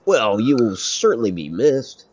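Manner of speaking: normal talking voice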